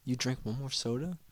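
quiet, mock menace; a softly spoken, joking threat; threatening dentist. Dentist menacing a patient over soda, quiet mock threat. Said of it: "They always threaten me".

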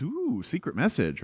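hushed intrigue. Acted. Plays king to his attendants.

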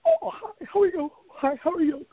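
high, sugary fake-polite hello; mock bougie greeting. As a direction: chirp it like a stuck-up host. Mocking bougie greeting at cafe.

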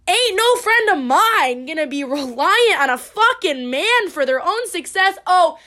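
loud, rising sassy scolding; sassy lecture. Retold or imitated. Lecturing friend about relying on man.

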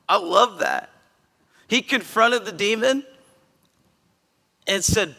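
ordinary talk, nothing marked

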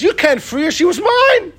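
high-pitched protest, climbing sharply on "mine"; indignant objection. Acted. Sarah disputing Avram's freeing of her maid, indignant. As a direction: stake an outraged claim, voice leaping up.

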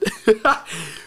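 high burst of real laughter; laughing. Real, not acted. Speaker recalls laughing uncontrollably at the reply, real laughter. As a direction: break into a giddy laugh mid-story.

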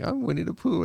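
high-pitched cartoon bear introduction; Winnie the Pooh. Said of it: "He's like".

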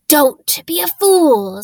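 put-on menacing snarl at the boy. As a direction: spit the warning slowly. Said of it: "snarled the face".

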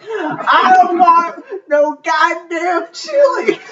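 put-on high, slow, laughing old-lady whine; hammed-up old woman refusing food, laughing through it; cranky old lady. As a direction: drag it out like a grumpy great grandma. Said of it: "She's like".

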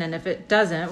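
usual speaking voice

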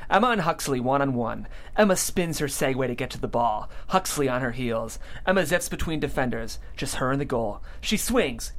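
ordinary talk, nothing marked

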